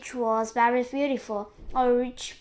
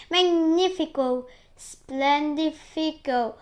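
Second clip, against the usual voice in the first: higher, louder exclamations; cried out; 5 semitones higher, 6 dB louder, slower than usual. A narrator's reading. An aunt exults over the peach, cried out. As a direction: stretch out each word in delight. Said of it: "and cried out".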